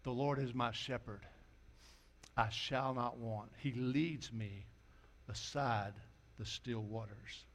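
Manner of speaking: low, soft and measured recital; hushed, low-pitched verse reading; quiet scripture recitation. Acted. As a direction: recite the psalm softly, unhurried.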